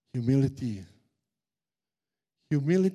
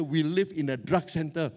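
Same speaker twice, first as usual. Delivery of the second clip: usual speaking voice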